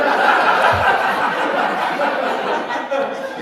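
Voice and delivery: loud high burst of laughter. Real, not acted. Laughs after the quoted advice.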